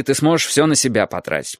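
plain reading voice

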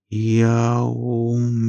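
slow, strained, otherworldly question; struggling dead girl's voice. A narrator's reading. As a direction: drag each word out with effort. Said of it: "с трудом удалось произнести ей". (с трудом, медленно, потусторонним голосом)

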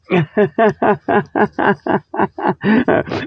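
long wheezy chuckle in a steady run; real laugh. Real, not acted. Host amused at his own bit, laughing. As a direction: crack up at your own joke, pulse after pulse.

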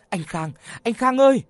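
high, loud panicked calling. Acted. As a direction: cry out in panic for help. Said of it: "Mỹ Phương hốt hoảng". (gọi hốt hoảng)

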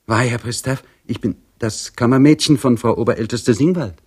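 stammering maid. A narrator's reading. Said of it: "stotterte sie".

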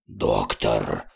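very low, croaking alien voice; hoarse creature head. A narrator's reading. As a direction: croak it deep in your throat. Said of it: "прохрипела левая голова двуглавого Юла". (низкий хрип инопланетного существа)

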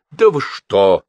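high, loud shocked protest; flustered exclamation; startled cry. A narrator's reading. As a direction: cry out, taken aback and embarrassed. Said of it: "вскрикнул Газ".

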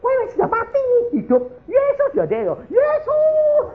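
seruan melengking tinggi, makin memuncak di akhir; menirukan teriakan histeris. Retold or imitated. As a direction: tirukan istri menjerit memanggil Tuhan. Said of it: "teriak Yesus". (jeritan tiruan bernada sangat tinggi)

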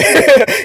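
sharp high laugh; quick real laugh. Real, not acted. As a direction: laugh mid-sentence. Laughing mid-sentence.

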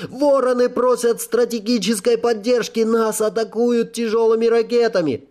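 high panicked radio cry; hysterical scream. A narrator's reading. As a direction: scream it in panic over the comms. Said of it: "истерический крик кого-то из операторов".

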